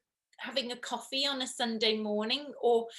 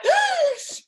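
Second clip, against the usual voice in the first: sharp high gasp of mock horror; a high, loud pretend gasp; mock horrified gasp; 8 dB louder than usual. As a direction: gasp like a man appalled. Imitating men recoiling.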